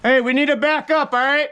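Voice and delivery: high, urgent call to pull back; frantic retreat order. Real, not acted. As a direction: hurry them back. Calling retreat under fire.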